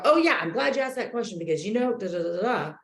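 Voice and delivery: mock-keen quick reply; rushing a quick eager answer; eager instant answer. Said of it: "you immediately go".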